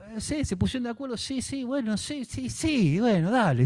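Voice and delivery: voz mucho más aguda y rápida del rey aceptando; rey Darío complaciente. Acted. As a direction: hacer de Darío dando el sí apurado. (los sátrapas convencen a Darío, voz aguda y rápida)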